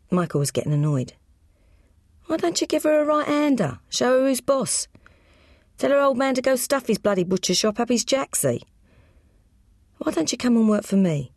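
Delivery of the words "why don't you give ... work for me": higher, brash Cockney goading; cocky gangster brother. Acted. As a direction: push him to stand up for himself. Michael eggs his brother on.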